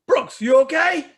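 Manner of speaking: high, loud concerned coach calling out; a coach shouting across the field to a hurt player; coach's voice. Retold or imitated. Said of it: "and he says".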